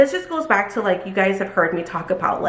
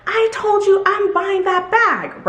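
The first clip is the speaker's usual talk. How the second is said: higher, slow mock whine; mocking irate customer; 12 semitones higher, slower than usual. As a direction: repeat the demand like a stubborn shopper. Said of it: "She was like".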